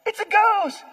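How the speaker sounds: high, alarmed cry of a ghost sighting; panicked disciple. Retold or imitated. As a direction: blurt it out like a scared fisherman. Other disciples panicking in boat.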